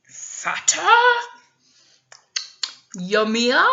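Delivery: hungry troll drooling at the thought of a fatter goat. Acted. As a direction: savour the words like a hungry troll. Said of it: "The troll started to drool".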